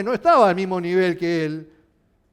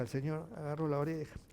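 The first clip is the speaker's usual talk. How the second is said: usual speaking voice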